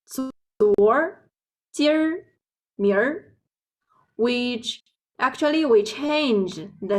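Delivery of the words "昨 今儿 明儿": 昨, 今儿, 明儿 with the Beijing er ending; Beijing accent demo. Shown as a demonstration. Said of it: "in our accent, we say it like".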